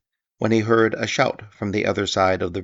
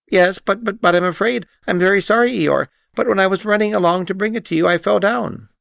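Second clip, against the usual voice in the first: high squeaky stammer, rushing; nervous little pig tripping over his words; flustered Piglet; 12 semitones higher, 6 dB louder than usual. A narrator's reading. Piglet confessing to Eeyore, stammering high and fast.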